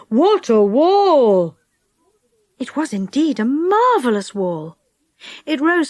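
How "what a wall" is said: long, drawn-out exclamation; awed excitement. A narrator's reading. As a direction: stretch the words out in amazement. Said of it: "in excitement".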